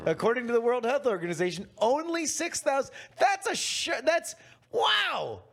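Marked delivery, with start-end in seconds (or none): 1.8-2.9 higher, stretched "only"; hammed-up astonished announcement; mock amazement. Mock amazement at official figure. 3.2-5.4 much higher, hammed-up awe; mock amazement. Mock amazement at official figure.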